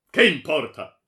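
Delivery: very loud, high retort; furious dismissal. A narrator's reading. Baron dismissing his daughter's plea, furious. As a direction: shout her plea down.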